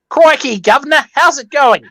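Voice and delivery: bad British accent sliding into Australian; mock British accent. Showing off a British accent to the group, badly. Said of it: "I can do a brilliant British accent".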